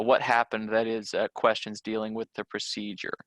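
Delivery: normal talking voice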